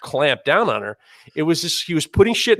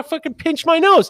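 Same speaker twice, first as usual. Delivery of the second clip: indignant outcry; 14 semitones higher, 4 dB louder than usual. Real, not acted. Outrage at nose pinched.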